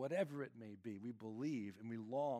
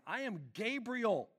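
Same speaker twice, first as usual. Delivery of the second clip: much higher and louder, proclaiming with authority; a heavenly messenger announcing himself; booming angelic declaration; commanding angel Gabriel; 9 semitones higher, 7 dB louder than usual. Retold or imitated. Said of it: "He's like".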